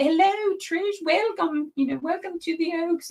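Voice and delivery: a playful fake British welcome; mock British accent. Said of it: "with a British accent".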